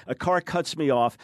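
ordinary talk

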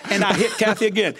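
usual speaking voice

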